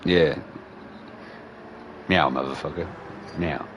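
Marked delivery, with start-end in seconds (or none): 2.1-3.7 low, quiet, unbothered meow; chill cat. Retold or imitated. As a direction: voice a cat who couldn't care less.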